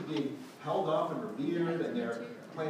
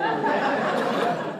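a loud rising laugh; real laughter; 11 dB louder than usual. Real, not acted. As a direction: laugh out between lines. Laughing while telling story.

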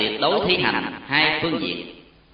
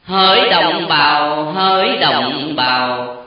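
slow, louder solemn call to the people; solemn preacher's call; 5 semitones higher, 3 dB louder, slower than usual. A narrator's reading. Master addressing thousands of villagers, slow solemn call. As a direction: draw out each word like an orator. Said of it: "Đức Thầy liền cất giọng".

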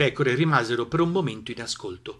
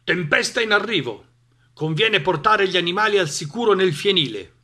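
loud, raised farmer's voice; old farmer; 7 semitones higher, 6 dB louder than usual. A narrator's reading. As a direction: call out the warning loudly. Farmer warns about storm. (contadino che avverte della tempesta)

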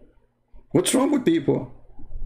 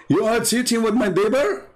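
mock shocked; 8 semitones higher than usual. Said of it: "he find his wife cheating".

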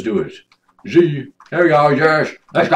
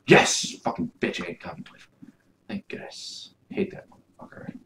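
gleeful whisper; 10 semitones lower, 11 dB quieter than usual. Acted. Player whispers insults after the companion leaves, hushed and gleeful. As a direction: whisper the insults under your breath.

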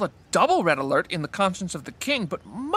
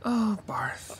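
breathy despairing whisper; dismayed whisper; 5 dB quieter than usual. Acted. Friend dismayed at Barth's hostage scheme, whispering. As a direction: let it out under your breath.

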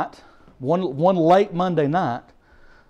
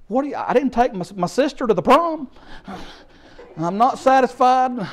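ordinary talk

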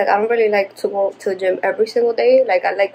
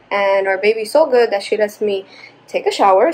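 usual speaking voice

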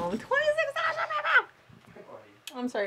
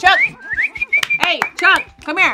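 calling the dog; 9 semitones higher, 13 dB louder than usual. Real, not acted.

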